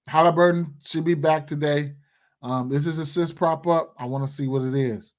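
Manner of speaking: ordinary delivery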